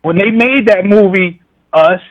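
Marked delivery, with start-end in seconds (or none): none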